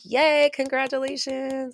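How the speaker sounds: excited cheer. Real, not acted. Cheering a listener's new business.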